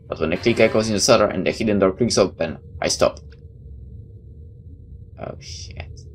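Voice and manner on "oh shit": whispered oh shit. Acted. Door clicks open, startled.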